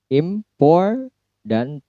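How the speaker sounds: Important, POR drawn out high and slow; slow teaching demo of Important; stress on POR. Shown as a demonstration. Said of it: "ditekan adalah syllable poor".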